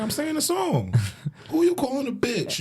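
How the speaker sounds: high mock innocent protest; innocent kid. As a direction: kid insisting it's just a song. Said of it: "I'm like".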